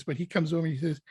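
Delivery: ordinary delivery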